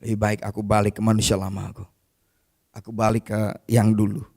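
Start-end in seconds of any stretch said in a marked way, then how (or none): none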